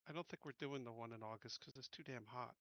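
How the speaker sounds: normal talking voice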